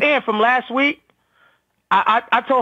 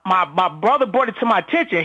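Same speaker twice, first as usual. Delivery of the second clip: normal talking voice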